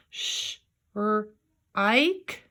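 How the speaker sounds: SH, R, IKE as separate sounds; sounding out long I. Shown as a demonstration. Teacher checking the other way, SH, R, IKE sounded apart. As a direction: break it into SH... R... IKE. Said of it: "try it the other way".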